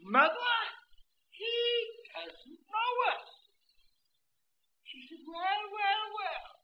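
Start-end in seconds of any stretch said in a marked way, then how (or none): 0.0-3.3 put-on high, pleading old man; an old man, hurt he's forgotten; high old man calling to his wife. Old man voiced in story. 5.2-6.5 put-on high, sing-song old lady; a sweet old wife marveling; lightly sung old woman's well well. Old wife voiced in story.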